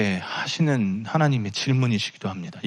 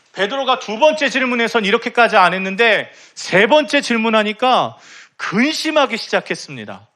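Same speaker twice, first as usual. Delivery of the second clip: fervent preaching; 10 semitones higher than usual. Acted. Pastor urges the congregation toward repentance, fervent preaching. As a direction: preach it with rising fervor. Preaches on weeping before God.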